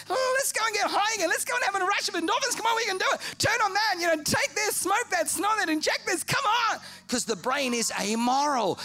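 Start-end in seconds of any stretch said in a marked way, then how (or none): 0.1-6.8 high, fast urging voice; fast high cartoon urging; craving body. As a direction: rattle it off like an addict's craving. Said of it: "your body's like".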